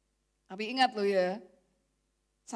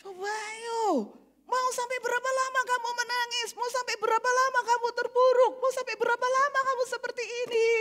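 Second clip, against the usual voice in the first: insistent; 9 semitones higher than usual. Acted. Pressing the question.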